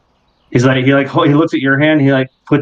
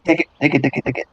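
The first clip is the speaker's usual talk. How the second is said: eager little creature; 6 dB quieter, much faster than usual. Acted. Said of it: "like, looking directly".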